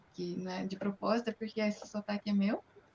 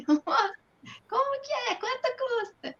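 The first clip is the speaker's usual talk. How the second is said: much higher, sing-song shop question; typical Brazilian asking; 13 semitones higher than usual. Retold or imitated. [name] imitates a Brazilian asking a price, high and sing-song. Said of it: "não é muito assim?".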